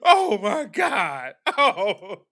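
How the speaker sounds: drawn-out "oh my God" breaking into laughter; mock horror. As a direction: play appalled and fail to keep it straight. Mock wailing over her supposed tears.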